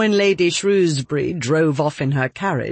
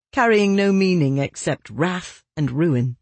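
ordinary delivery, nothing marked

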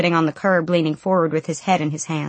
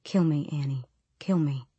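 low, soft plea fading to a whisper; very calm, very soft; 3 semitones lower, 6 dB quieter than usual. A narrator's reading. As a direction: beg calmly, with no force. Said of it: "His voice was very calm, very soft".

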